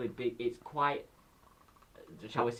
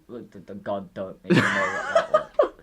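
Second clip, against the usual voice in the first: ordinary delivery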